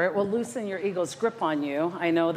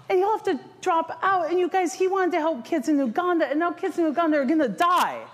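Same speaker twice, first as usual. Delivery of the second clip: mock panic; 9 semitones higher than usual. Panicked spiral about kid.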